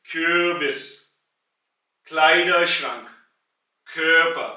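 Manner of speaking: each word loud and carefully said, Kleiderschrank very slow; German word drill. Shown as a demonstration. Teacher recapping the kh sound, loud and slow. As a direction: run through the three words as a model list. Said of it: "खा की आवाज उसी तरीके से".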